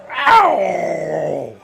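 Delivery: long loud howl of pain. Acted. Game master voicing a slashed fighter's pain, loud howl. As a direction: cry out high and loud, drawing it out. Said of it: "and just going".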